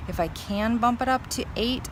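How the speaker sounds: ordinary talk, nothing marked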